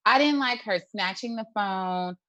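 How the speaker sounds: ordinary talk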